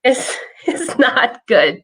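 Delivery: mock dismay, drawn out; dawning dread. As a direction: let it sink in slowly with a nervous laugh. Retells dread at frozen screen.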